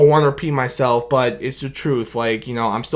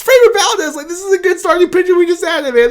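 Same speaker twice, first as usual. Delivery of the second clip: much higher, laughing with delight; overly excited; 14 semitones higher, 3 dB louder than usual. Real, not acted. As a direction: gush about the new pitcher. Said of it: "this overly excited Detroit Tigers fan".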